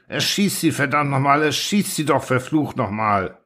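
high, desperate furious yell that sinks back at the end; furious bellow. A narrator's reading. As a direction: roar at them to shoot the horses. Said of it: "Detering wird wütend und brüllt". (wütend brüllen, hoch und verzweifelt)

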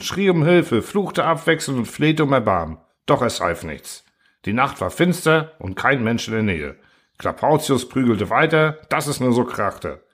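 ordinary delivery, nothing marked

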